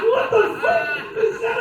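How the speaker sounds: bewildered yelp at the question; baffled outburst. Retold or imitated. Baffled reaction to his wife.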